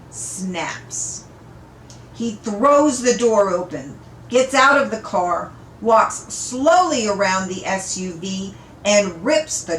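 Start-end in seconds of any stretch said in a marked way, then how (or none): none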